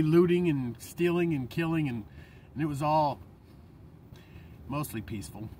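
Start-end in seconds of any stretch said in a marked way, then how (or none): 4.7-5.5 dry sarcasm. Acted. Sarcastic jab at news.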